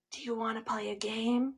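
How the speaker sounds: soft creepy question to a victim; Ghostface. Pretend. Ghostface playfully luring a phone victim, hushed menace. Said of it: "And he's like".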